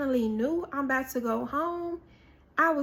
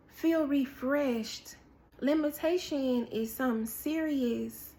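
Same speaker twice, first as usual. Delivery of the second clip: ordinary delivery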